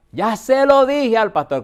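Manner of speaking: loud, slow, indignant cry; the old woman yells her accusation at her daughter; retold shout. Bedridden mother shouting at her daughter in front of the pastor, loud and slow. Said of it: "Cuando esa mujer gritó".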